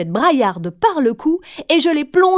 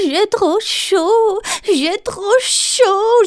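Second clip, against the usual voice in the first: high, slow whimpering little voice; whining Galipette; 8 semitones higher, 4 dB louder, much slower than usual. A narrator's reading. The left hand Galipette complains of the heat, high slow whine. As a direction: moan it out like a hot little hand. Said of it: "gémissait Galipette".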